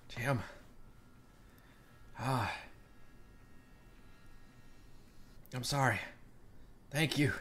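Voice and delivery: very soft, halting goodbye; quiet sorrowful farewell. Acted. Rye leaving Sham behind, hushed and sorry. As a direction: say it softly, with long pauses. Rye's farewell to sacrificing companion.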